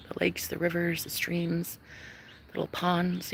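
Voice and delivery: hushed, breathy aside listing options; whisper. Acted. Vlogger whispers the dull safe choices to viewers, hushed. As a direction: whisper it like a secret.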